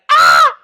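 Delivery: very high, loud shriek; short piercing scream, imitated; mock scream. Retelling the kid screaming again, mock shriek. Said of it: "He screamed again".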